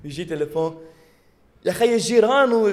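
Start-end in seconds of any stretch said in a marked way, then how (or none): 1.6-2.7 loud, laughing phone talk; hammed-up loud phone call; loud phone chatter. Imitating a loud phone caller.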